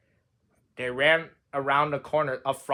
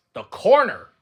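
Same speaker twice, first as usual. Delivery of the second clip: ordinary delivery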